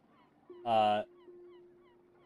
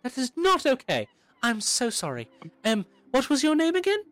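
frazzled teacher voice; 4 dB louder than usual. Acted. Teacher scolds child mid-greeting.

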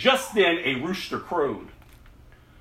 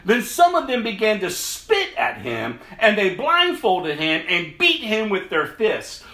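Bible passage read aloud with feeling; storyteller reading scripture; dramatic scripture reading; 4 semitones higher than usual. A narrator's reading. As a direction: tell the trial of Jesus to the congregation. Preacher reads the Gospel passage.

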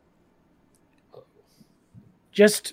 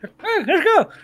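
deer voice; 13 semitones higher, 7 dB louder than usual. Acted. Voicing the deer through book.

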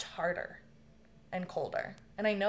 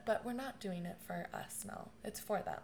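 much softer, lightly voiced gentle mother; mom's soft reply; 10 dB quieter than usual. A dying mother gently reassuring her daughter, softly. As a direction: let it come out quiet and kind.

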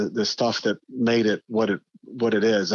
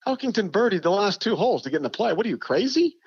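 arguing back; 9 semitones higher than usual. Retold or imitated. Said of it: "We got in those arguments".

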